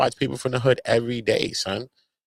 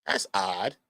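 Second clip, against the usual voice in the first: quiet deadpan; 7 semitones lower, 11 dB quieter than usual. Retold or imitated. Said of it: "I'm like".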